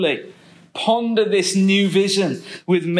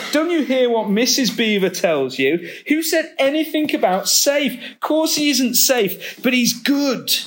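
raised, insistent beaver voice; emphatic Mister Beaver; 6 semitones higher than usual. A narrator's reading. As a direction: insist he's good, full of awe. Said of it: "said Mister Beaver".

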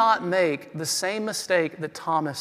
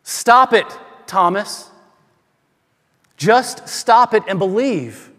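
higher, louder scolding yell; 4 semitones higher, 7 dB louder, slower than usual. Shown as a demonstration. Pastor demos Jesus scolding doubting Thomas, angry yell. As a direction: bark it at him like an exasperated parent. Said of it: "Do you hear them in a yelling tone?".

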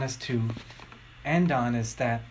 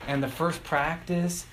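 ordinary delivery

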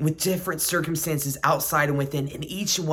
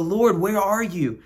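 raised, drawn-out cry to God; anguished plea; 4 semitones higher, 3 dB louder, slower than usual. Acted. Hurting believer calls out to an absent Lord, pleading. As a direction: plead upward, slower and higher, searching for Him. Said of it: "we can feel".

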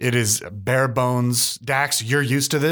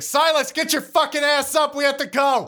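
high, tense voice of an angry official; furious but trying to keep a lid on it; barely held-back anger; 12 semitones higher than usual. Acted. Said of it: "trying desperately not to be incredibly angry".

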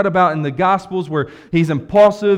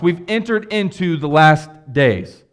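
normal talking voice

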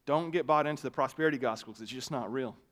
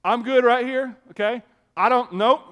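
retold refusal; 7 semitones higher, 9 dB louder than usual. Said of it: "I'm like".

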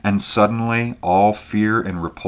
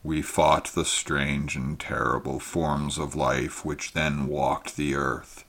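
soft, sorrowful old alien being, lightly voiced; a weary, gentle voice from another world; ancient creature; 3 semitones lower, 7 dB quieter than usual. A narrator's reading. Ancient creature speaks to Conan.

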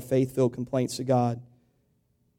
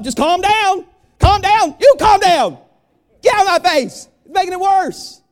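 higher, much louder exasperated yell; heated calm down shouting; 10 dB louder than usual. Retold or imitated. Preacher acting out an anxious person snapping at a helper, loud exasperated yell. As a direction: snap back as the one being told to calm down. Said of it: "You can tell I've experienced this before".

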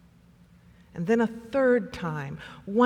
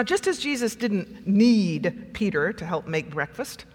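ordinary talk, nothing marked

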